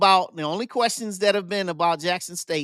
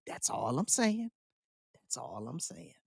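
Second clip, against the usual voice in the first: fading; 4 semitones lower, 12 dB quieter than usual. Real, not acted. Trailing repeated tag.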